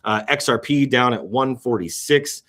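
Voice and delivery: usual speaking voice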